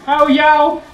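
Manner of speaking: high, loud mock Cockney accent; hammed-up Cockney accent. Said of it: "Why are you Cockney?".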